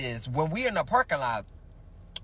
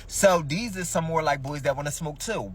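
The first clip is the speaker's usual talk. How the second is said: ordinary talk, nothing marked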